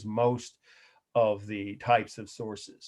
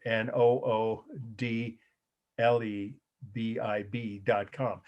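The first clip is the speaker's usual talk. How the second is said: slow letter-by-letter dictation; spelled out slowly; slower than usual. Real, not acted. Teacher spelling a web address for students, slowly. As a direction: spell it out with space between the letters. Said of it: "spell it for you again slowly".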